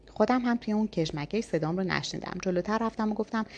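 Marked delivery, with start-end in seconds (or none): none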